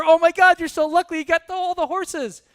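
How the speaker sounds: very high, loud gushing neighbor; acted excited congratulations. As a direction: gush over the farmer's luck at high pitch. Neighbor runs over to congratulate farmer.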